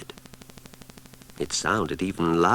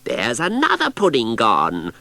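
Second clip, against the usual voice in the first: shouted; 3 semitones higher, 8 dB louder than usual. Acted. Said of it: "shouted Cassandra".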